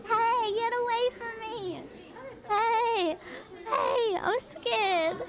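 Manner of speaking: high-pitched playful crab voice; scared little crab. Pretend. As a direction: squeal it like a frightened little critter, in play. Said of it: "Look at them, they're like".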